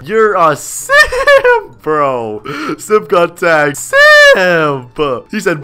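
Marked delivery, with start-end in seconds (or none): 0.0-2.4 slow, high, drawn-out taunt; mocking. Real, not acted. Teasing his brother for simping over the girl, slow mock. 2.8-5.3 taunting. Real, not acted. Taunting brother.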